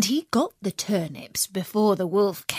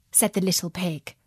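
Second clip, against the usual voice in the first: ordinary delivery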